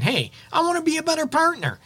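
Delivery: high, fast, eager offer; eager partner. Acted. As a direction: burst out keen to please.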